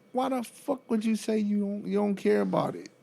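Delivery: high, indignant rant. Real, not acted. Rant at uncaring caregiver.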